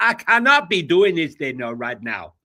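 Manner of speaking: put-on Nigerian accent. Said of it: "in a Nigerian accent?".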